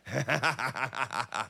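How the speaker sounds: hammed-up, drawn-out growl; mock growl. Preacher imitating his headstrong kid, mock growl. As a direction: growl like a strong-willed kid, jokingly. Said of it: "a look in their eye like".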